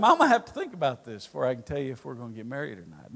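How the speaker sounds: low, soft, rapid mock reply; mumbled stalling. Mom putting off dad's proposal, low and hurried. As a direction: rattle it off low, imitating a hedge. Mother's stalling answer mimicked.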